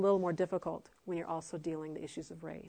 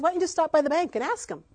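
quoted suggestion; 8 semitones higher, 5 dB louder, faster than usual. Retold or imitated. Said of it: "So I said".

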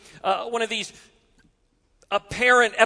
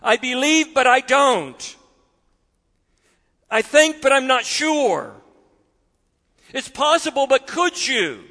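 desperate; 5 semitones higher than usual. Acted. Father's desperate paraphrase.

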